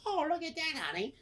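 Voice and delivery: high falsetto sightseeing wife; wife impression. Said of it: "his wife's like".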